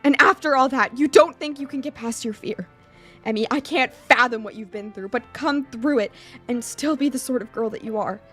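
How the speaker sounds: loud, insistent urging; impassioned plea. Acted. Hisao urging Emmy past her fear, impassioned. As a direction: push back hard, then ease off.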